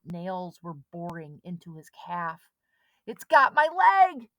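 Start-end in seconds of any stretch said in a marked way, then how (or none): 3.1-4.3 high, loud, slow terrified scream; boy grabbed by a shark; screaming in pain. A narrator's reading. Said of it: "Chet screamed".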